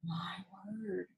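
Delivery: breathless whispered amazement; dad whispering in disbelief; stunned whisper. A narrator's reading. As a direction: barely breathe it out. Father stunned by the crow.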